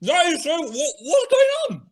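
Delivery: high, stunned outburst; startled by a salty taste; shocked. Real, not acted.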